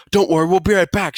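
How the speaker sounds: put-on high, loud child's reassurance; little sister. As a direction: reassure your brother in a kid's voice.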